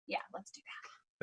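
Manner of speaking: hushed, breathy whisper of agreement; soft conspiratorial whisper; whispered quote. Retold or imitated. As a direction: whisper it like a sly plan. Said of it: "She's like".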